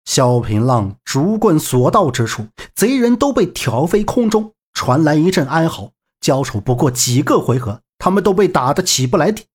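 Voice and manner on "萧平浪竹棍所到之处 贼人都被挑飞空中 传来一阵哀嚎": usual speaking voice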